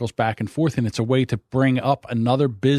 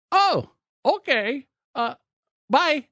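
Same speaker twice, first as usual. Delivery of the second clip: high, slow put-on caller voice, much higher than his own; imitating the telemarketer; much slower than usual. Retelling a telemarketer's call to his friend, high mocking caller voice. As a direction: mimic the overseas sales caller, drawn out. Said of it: "I go".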